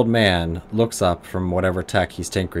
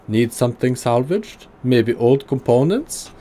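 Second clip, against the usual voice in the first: put-on gruff German accent, pitched higher; 5 semitones higher, 4 dB louder than usual. Old tinkerer greeting the player in a higher gruff German accent. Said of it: "heavily accented German tonality".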